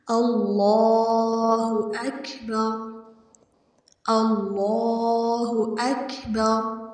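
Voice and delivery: slow, with the ل in اللہ said thick and full; slow Quranic recitation of اللہ اکبر; heavy Allah. Shown as a demonstration. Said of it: "لفظ اللہ کو ہم نے موٹا پڑھنا ہے".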